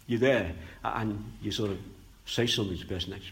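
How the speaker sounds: ordinary delivery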